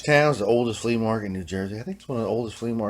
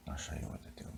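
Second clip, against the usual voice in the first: whisper; 7 semitones lower, 21 dB quieter than usual. Pretend.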